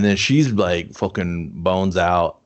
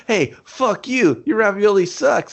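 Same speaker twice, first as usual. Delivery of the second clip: high-pitched taunting call, drawn out at first; mock taunting; 10 semitones higher than usual. She insults the man chasing her, high mock taunt. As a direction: imitate her goading him from a distance.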